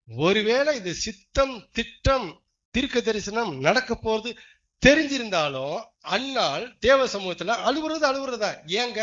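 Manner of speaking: loud, slow emphatic pulpit voice; fervent preaching. Acted. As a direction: drive the point home from the pulpit. Preaching climax. (உரத்த உணர்ச்சிமிக்க பிரசங்கம்)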